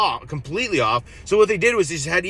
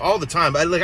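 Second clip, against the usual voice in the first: ordinary talk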